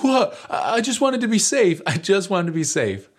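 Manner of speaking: high, plaintive mock protest; imitated rattled friend. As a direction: mimic a rescued kid defending himself, starting loud and high. Imitates rescued friend after panic.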